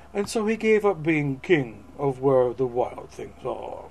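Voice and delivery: theatrical, swelling and easing narration; dramatic picture-book reading; storybook read-aloud. Acted. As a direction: read it grandly to an audience. Reads the book text aloud.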